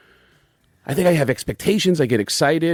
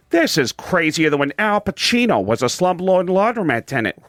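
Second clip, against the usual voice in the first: raised-pitch cartoon dad impression; Peter Griffin; 5 semitones higher than usual. Guest quoting Seth MacFarlane's recording session, as Peter Griffin. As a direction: do the cartoon character's swooping delivery. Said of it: "one line as Peter Griffin".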